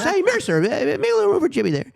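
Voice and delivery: high mock host, dismissive; Jay Leno voice. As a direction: toss off a hollow compliment. Jay addressing Marissa on air.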